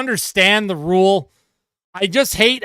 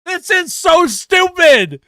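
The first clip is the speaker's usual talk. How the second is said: disgusted rant; 9 semitones higher, 3 dB louder, slower than usual. Real, not acted. Ranting at the call.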